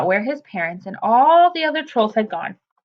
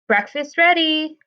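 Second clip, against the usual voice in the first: slow, high sing-song call; 7 semitones higher than usual. A narrator's reading. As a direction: call the kids to breakfast. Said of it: "called, uh, Adiola".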